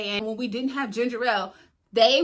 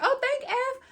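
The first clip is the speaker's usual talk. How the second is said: high, lilting thank-you; coy thanks; 13 semitones higher, slower than usual. Retold or imitated. Woman thanking the guy at the bar, coy high thanks. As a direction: lift the voice way up, girlish and grateful.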